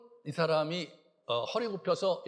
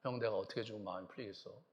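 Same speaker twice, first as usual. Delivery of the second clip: put-on much softer, gentle plea to an older brother; tender apology; 9 semitones lower, 14 dB quieter than usual. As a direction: say sorry softly, heart open. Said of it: "그렇게 얘기해야 되잖아요".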